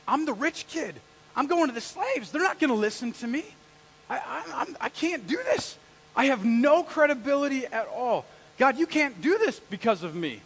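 high-pitched, insistent excuse-making; self-doubting protest. Acted. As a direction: argue that you are the wrong one. Acts Moses doubting himself to God.

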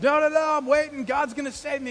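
shouted up high and loud, insistent; mock shout back. Stranded man shouting back at the ladder rescuer, loud and high. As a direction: yell it up at the rescuer, stubborn. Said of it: "the guy shouts back".

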